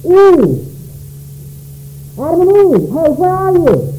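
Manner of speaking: calling out. Acted. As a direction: call out for them as if they are hiding. God calling out in the garden.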